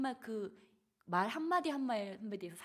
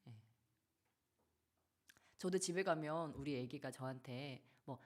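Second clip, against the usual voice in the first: ordinary talk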